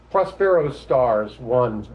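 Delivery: slow, deliberate reading of a title; poem title announcement. Acted. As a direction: announce the title to open a recital. Title read to open poem.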